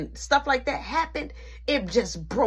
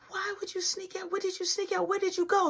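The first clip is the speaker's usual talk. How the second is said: high, softer probing grandma; grilling grandma; 11 semitones higher, 4 dB quieter than usual. Retold or imitated. Nana interrogating her grandson, high and probing. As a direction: keep pressing for where he went.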